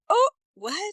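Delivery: high, loud startled confusion; baffled surprise. Acted. Listener thrown by wrong stress, loud baffled surprise. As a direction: react as if the brain just slammed on the brakes. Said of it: "our brain puts on the brakes".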